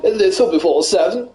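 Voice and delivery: a light posh runner voice. Acted. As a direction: play a smug posh jogger. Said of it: "it's my new voice".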